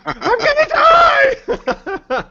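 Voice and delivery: high, stretched-out panicked wail; retold screaming. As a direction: act out a hammed-up dying yell. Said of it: "I'm, like, screaming for her".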